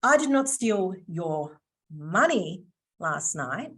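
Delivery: slow, with "money" set apart and swooping up; pause, then lean on "money"; stress on MONEY. Shown as a demonstration. Trainer hints she stole something else, stress on "money". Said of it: "Wasn't your money I stole".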